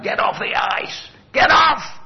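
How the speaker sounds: high shout that builds to a yell; retold scream. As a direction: bellow the warning across the ice. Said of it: "the people screaming at".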